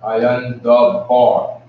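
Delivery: drawn out, each word separate; very slow demo. Shown as a demonstration. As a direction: stretch every word for learners. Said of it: "which is comprised of two words".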